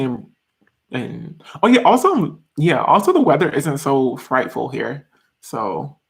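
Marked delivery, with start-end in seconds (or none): none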